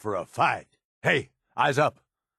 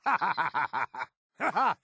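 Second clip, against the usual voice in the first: mocking laugh; 3 semitones higher than usual. Laughing at enemy in fight.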